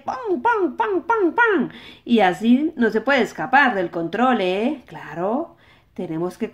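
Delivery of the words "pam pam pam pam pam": mock gunshots. Imitating gunshots.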